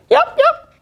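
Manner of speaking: very high squeaky cat cry. Acted. Cat voice in story.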